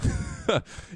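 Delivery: short unvoiced laugh, a huff of breath; real laugh. Real, not acted. Podcaster laughs at his own forgetfulness, breathy huff. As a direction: laugh at yourself under your breath.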